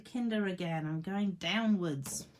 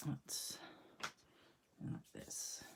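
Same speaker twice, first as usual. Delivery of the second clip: whispered aside; 5 semitones lower, 15 dB quieter, slower than usual. Real, not acted. Muttering to self.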